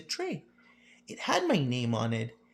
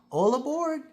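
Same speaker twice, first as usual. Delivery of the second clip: conductor's call; 10 semitones higher than usual. Acted.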